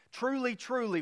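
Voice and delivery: both "truly" words pitched high and stressed; emphatic truly truly. Shown as a demonstration. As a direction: ring out "Truly, truly" like a solemn oath. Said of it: "the purest translation of the word".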